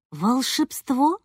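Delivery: slow, drawn-out wonder; slow, teasing, rising mystery; mysterious. A narrator's reading. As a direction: stretch it out like revealing a magic trick. Mysterious explanation of the trick.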